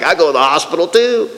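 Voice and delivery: lightly voiced old man chiming in; a hint of an eager old-timer; old man imitation. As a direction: lightly mimic an elderly me-too. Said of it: "the old guy in the walker was like".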